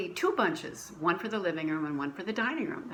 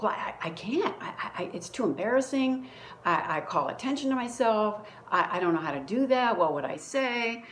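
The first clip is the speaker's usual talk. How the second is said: flustered protest; 4 semitones higher, 4 dB louder than usual. Retold or imitated. Arguing back with the voice.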